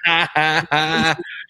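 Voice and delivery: loud, high laugh breaking out; real laugh at the friend's story; burst of laughter. Real, not acted. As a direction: crack up at the punchline. Host cracks up at story.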